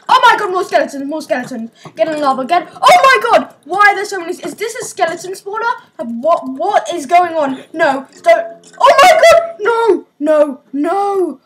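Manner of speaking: loud, frantic cries as skeletons swarm; panicked yelling. Real, not acted. Gamer shouting at a swarm of skeletons, panicked.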